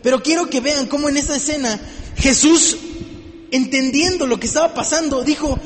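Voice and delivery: ordinary talk, nothing marked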